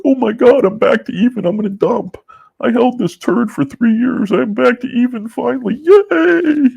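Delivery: very high squeaky whiny mimicry; mock excited investor. Mocking investors who dump at breakeven, squeaky mock glee.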